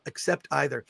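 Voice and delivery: ordinary talk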